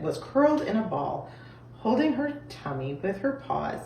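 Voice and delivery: plain reading voice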